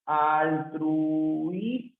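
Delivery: "altrui-" drawn out very slowly, each syllable held; slow syllable-splitting demo of "altrui-". Shown as a demonstration. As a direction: stretch "altrui-" out, almost sung. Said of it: "O también puede ser".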